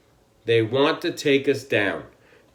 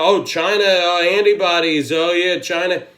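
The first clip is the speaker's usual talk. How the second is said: mocking; 4 semitones higher, 5 dB louder than usual. Mocking gullible people's talk.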